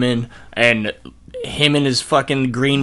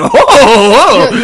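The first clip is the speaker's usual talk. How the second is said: mock amazement; 10 semitones higher, 10 dB louder, much slower than usual. Voicing young Ramirez amazed.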